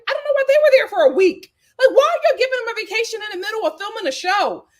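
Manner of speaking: fast, high, loud rant; indignant. Real, not acted. Vlogger ranting about the vacation, fast and high. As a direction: rattle it off in outrage.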